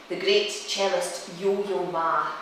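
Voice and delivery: usual speaking voice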